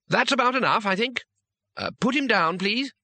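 lightly voiced timid man, pitched high; high, tentative polite plea; hesitant intervention. A narrator's reading. Timid owner intervenes in fight.

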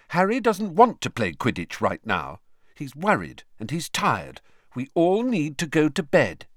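pointed disapproving tone; reproachful. Acted. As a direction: tell him off for being insensitive. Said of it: "I don't think you're being very sensitive sort of voice".

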